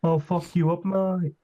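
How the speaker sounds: high-pitched mock Australian threat; hammed-up Australian accent. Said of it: "try to do Australian".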